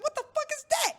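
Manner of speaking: very high, startled disbelief; shocked squeal. Retold or imitated. Retold doctor visit.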